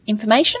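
"information" rising as if checking it makes sense; upward inflection. Real, not acted. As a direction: lift "information" into a question. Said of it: "finished it with an upward inflection".

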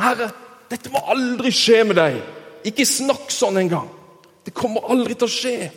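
Peter irettesetter. Acted. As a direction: firmly forbid your master to say it. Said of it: "irettesetter han sin mester og herre". (spilt, bestemt irettesettelse)

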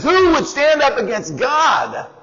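high, defiant challenge; a shepherd boy throwing down a challenge; bold young David. Retold or imitated. David defying Goliath, high and bold. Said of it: "and he says".

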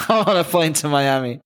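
amused mock echo of a friend; laughing echo. Real, not acted. Echoing friend's line, laughing.

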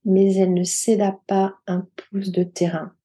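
plain reading voice

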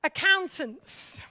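ordinary talk, nothing marked

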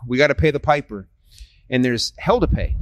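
ordinary talk

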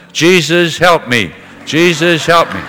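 loud, high cry of Jesus, help me; mother crying out loud in the nursing home; loud repeated plea. Retold or imitated. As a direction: call it out over and over, loud. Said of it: "in a very loud voice".

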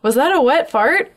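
high, startled retold exclamation; mock alarm in the dark; surprised. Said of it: "And I went".

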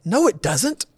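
quick high protest, half laughing; defensive denial. Retold or imitated. Husband protesting to his wife that his tone is fine, mock defensive. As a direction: brush her off with a mock protest.

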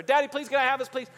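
put-on high, insistent begging kid; pestering child. Child asking Daddy after being refused, pestering. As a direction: plead like a nagging kid.

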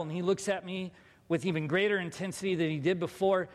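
ordinary delivery

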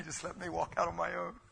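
laughing through the words; amused laugh mid-story. Real, not acted. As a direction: chuckle while telling it.

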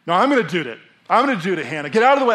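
loud, rising to a shout; pushy dad. Pretend. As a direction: bulldoze your daughter aside. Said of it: "if I was just like".

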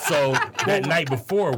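ordinary talk, nothing marked

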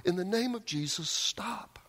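high repeated plea at moderate volume; acted yelling. Monk out in the battle arena, high acted yelling. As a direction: cry it out, pitch jumping on stop. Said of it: "and kept yelling".

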